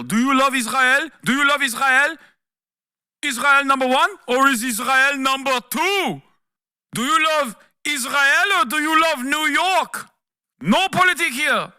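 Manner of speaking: high, loud sneering questioner voice; mock interrogator voice, way up high; mocking debate moderator. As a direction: ham up a pushy moderator grilling a candidate.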